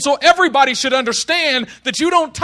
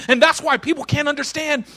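ordinary talk

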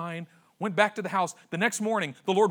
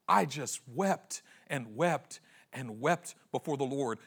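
normal talking voice

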